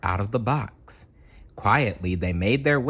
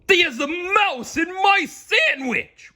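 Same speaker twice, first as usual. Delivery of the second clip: high, slow, strained giant roar; cartoon giant bellowing, high and slow; giant's roar; 4 dB louder, slower than usual. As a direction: bellow out the discovery slowly. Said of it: "roared the giant".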